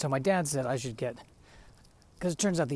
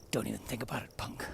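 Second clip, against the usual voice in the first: Clint Eastwood; 5 dB quieter than usual. Retold or imitated. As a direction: murmur a tough-guy threat, quietly. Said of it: "can't they get Clint Eastwood to do it".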